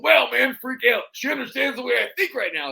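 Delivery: dub his real voice over the clip; Macho Man voice. Retold or imitated. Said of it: "like, his real voice".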